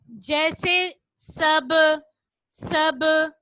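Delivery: सब with the final ब bounced back loudly; qalqala demo. Shown as a demonstration. Teacher showing children qalqala, bouncing the ब in सब. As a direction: release the ब in सब with a sharp echoing pop.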